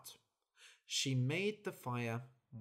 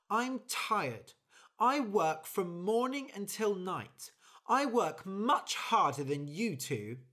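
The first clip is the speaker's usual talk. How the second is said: tired, fed-up bird; 7 semitones higher, 6 dB louder than usual. Acted. Bird complains to her friends.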